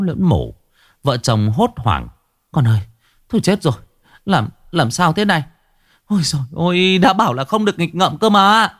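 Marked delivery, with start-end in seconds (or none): 2.5-8.7 high, frantic mother, worried and cross; panicked scolding. A narrator's reading. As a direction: shriek it out in a panic, half scolding. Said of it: "Vợ chồng Đông quát lên, giọng xen lẫn lo lắng và giận dữ". (giọng mẹ cao, hoảng hốt pha giận dữ)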